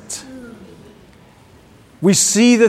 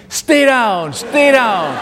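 raised, higher voice ordering a fighter down; retold yell at a video game; mock yelling; 4 semitones higher, 3 dB louder, much slower than usual. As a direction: yell it at the screen, held in a little. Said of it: "I'm yelling at the screen".

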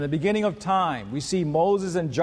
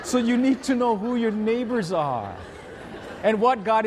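talking through laughter; words carried on a laugh; 4 semitones higher than usual. Real, not acted. Preacher still laughing after his mango joke, laughing.

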